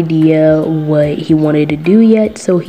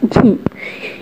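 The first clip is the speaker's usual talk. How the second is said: sulky whispered sigh; 4 semitones higher, 3 dB quieter than usual. Acted. Mikey sulking.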